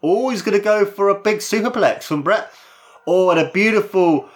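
high excited build-up; excited call. Real, not acted. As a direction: call the superplex with awe.

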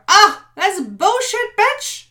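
loud, high, slow scornful shout; talking back to the inner critic, shouting; defiant talk-back. Pretend. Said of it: "I'll just turn around and go".

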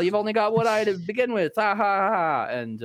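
normal talking voice

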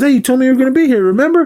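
high, fast, loud character quote; acted-out reminder. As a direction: imitate a rushed, insistent reminder. Acted reply between film characters.

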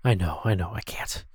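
soft unvoiced whisper. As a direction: whisper it back, hushed. Host whispers back to sidekick.

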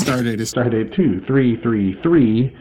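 formal captain's log announcement; announcer voice. Pretend. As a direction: read it like a starship captain. Announcing stardate.

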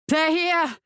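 high-pitched cry of alarm; warning shout. A narrator's reading. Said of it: "she shouted".

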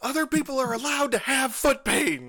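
high, loud indignant outburst; indignant yell. Retold or imitated. Friend keeps ranting at amputee, high and indignant. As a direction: shout it, still fed up. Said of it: "slams his hands down and points at me and goes".

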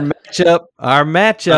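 high, loud, eager chant; excited interjection. Real, not acted. Jumps in excitedly about their bet.

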